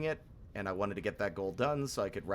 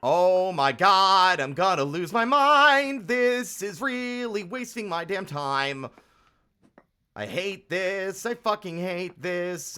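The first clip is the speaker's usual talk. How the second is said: loud, high, slow, boiling over; exasperated shout at the screen; real frustrated outburst; 8 semitones higher, 8 dB louder, slower than usual. Real, not acted. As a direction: lose it at the game. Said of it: "I'm actually angry".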